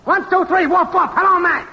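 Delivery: high-pitched, singsong mic-test chant; woofing mic-test yell. Acted. Technician testing if the show is on air, high woofing yell. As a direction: chant it high, evenly, like barked test words. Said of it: "woof woofing and yelling".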